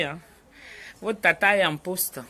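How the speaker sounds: ordinary talk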